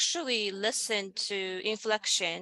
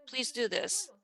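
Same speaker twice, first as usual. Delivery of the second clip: ordinary talk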